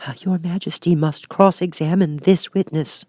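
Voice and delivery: plain reading voice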